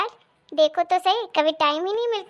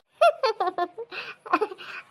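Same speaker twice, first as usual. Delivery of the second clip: helpless, muffled chuckling; stifled giggle; 3 semitones higher, 4 dB quieter than usual. Acted. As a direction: giggle you can't hold in. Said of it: "आपको तो बड़ी हंसी आ रही है".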